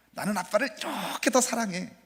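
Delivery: put-on high childlike son's voice; little son voice. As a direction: play the little boy declaring love. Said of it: "이렇게 얘기하는 거예요".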